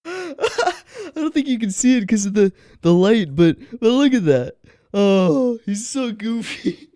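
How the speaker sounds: high-pitched talk through real giggles; laughing hard. Real, not acted. Creator showing the camera the goofy prop, laughing. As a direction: giggle through the words, voice lifted.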